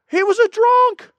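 high, loud, singsong gossip voice; mock townsperson. Townsperson gossiping about the convert, high and loud. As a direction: pitch it way up like a gossiping local.

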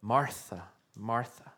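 soft, falling call of a friend's name; tender repeated name. Shown as a demonstration. Preacher showing how Jesus calls Martha, softly. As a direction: say the name twice, gently. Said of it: "repeated in this manner".

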